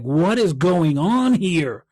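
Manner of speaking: slow, puzzled, drawn out; baffled inner question. Retold or imitated. Giver wondering why nothing comes back, slow bafflement. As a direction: spell out the confusion slowly. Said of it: "You're like".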